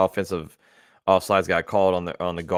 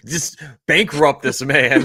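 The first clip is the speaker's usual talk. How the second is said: laughing; 5 semitones higher, 5 dB louder, slower than usual. Real, not acted. Joking about a huge fine.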